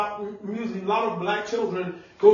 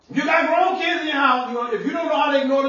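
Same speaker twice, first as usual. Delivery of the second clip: raised, higher preaching shout; preaching at full force; 4 semitones higher than usual. Acted. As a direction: drive the warning home to the congregation. Preacher warns parents.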